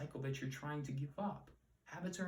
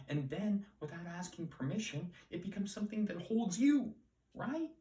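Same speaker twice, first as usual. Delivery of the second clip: rising dramatic emphasis; 7 semitones higher, 5 dB louder than usual. Real, not acted. Dramatic climax of habit point.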